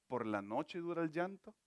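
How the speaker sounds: tender closing exhortation; soft intimate preaching. Acted. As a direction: draw the listeners close and comfort them. Closing part of the sermon.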